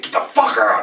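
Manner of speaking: loud, high, forceful shout; big boy voice. Retold or imitated. Music shop worker kicking out a rude customer, shouting. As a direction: bark him out of the shop. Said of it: "I got the big boy voice on".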